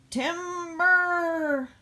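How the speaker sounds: high, loud call of timber. Acted. Backhoe calls out as the stump topples, loud. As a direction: sing out timber as it falls.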